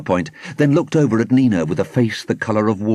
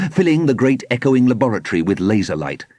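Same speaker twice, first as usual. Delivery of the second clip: ordinary delivery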